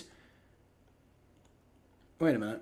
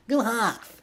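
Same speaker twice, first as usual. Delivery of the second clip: high, softer stab at Seattle; badly faked Seattle accent, pitched up; attempted Seattle accent; 6 semitones higher, 5 dB quieter than usual. Shown as a demonstration. Streamer retrying the Seahawks cheer, failed Seattle accent. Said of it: "I don't know how to do a Seattle accent".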